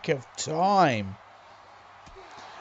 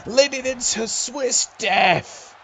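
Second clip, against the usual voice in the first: high, slowed, hyped call; excited move call; 9 semitones higher, 5 dB louder, slower than usual. Real, not acted. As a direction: sell the big move. Calling the signature move.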